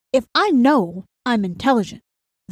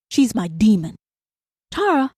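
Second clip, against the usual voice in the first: usual speaking voice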